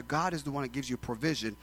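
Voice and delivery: ordinary delivery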